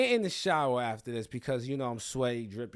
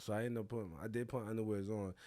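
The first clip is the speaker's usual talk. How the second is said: usual speaking voice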